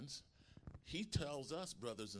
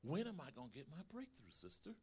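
fast, soft, higher doubting plea; doubting question; 5 semitones higher, 7 dB quieter than usual. Retold or imitated. Preacher mimicking a doubter asking a sister. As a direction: whine it like an impatient doubter. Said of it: "vocalizing my doubts".

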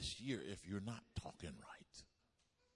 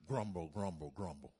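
acted-out grumble in descending beats; mock grumbling; 4 dB louder than usual. Preacher mimics complaining about neighbors' fireworks, mock grumbling. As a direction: grumble the word out, falling each time. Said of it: "I was grumbling, mumbling".

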